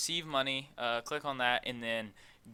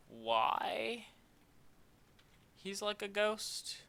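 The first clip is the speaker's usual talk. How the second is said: high puzzled voice; 9 semitones higher, much slower than usual. Real, not acted. Puzzled aside about a ghostly model.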